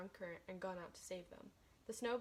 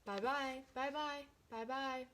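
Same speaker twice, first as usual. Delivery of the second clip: calling out goodbye; 4 semitones higher, slower than usual. Acted. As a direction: call across the ice to the girl. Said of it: "voices calling to her".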